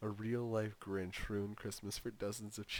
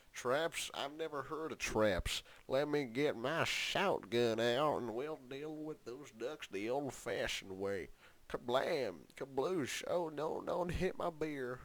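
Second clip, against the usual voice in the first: gruff tinny action figure; 5 semitones higher, 7 dB louder than usual. Retold or imitated. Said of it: "that's my impression of this guy".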